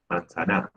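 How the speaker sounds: "manzana" stressed on final NA, low and soft; stress on last syllable. Shown as a demonstration. Teacher testing stresses for the class, final NA stressed in "manzana". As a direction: say "manzana" as man-za-NA, wrongly end-stressed. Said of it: "¿Cómo se pronuncia manzana?".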